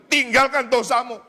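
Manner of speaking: high, forceful pulpit call; imitating a fiery preacher's order; preached command. Acts out his preached command.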